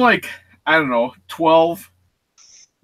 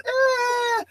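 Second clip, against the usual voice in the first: imitated scream; 4 dB quieter than usual. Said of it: "She's flapping her hands going".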